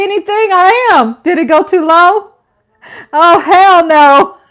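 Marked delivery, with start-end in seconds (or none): 3.1-4.4 loud, slow, drawn-out hell no; sassy hell no. Real, not acted. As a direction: refuse it with full sass. Mock indignant at camera angle.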